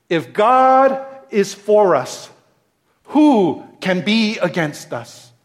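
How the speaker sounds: slow, lifted reading of Romans; slow, weighty reading. Shown as a demonstration. Preacher reading Romans aloud to his church, slow and weighty. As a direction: let each word of the verse land. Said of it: "it says this".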